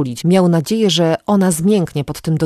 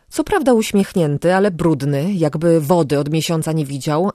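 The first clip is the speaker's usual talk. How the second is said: ordinary delivery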